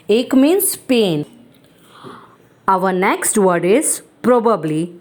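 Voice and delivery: ordinary talk, nothing marked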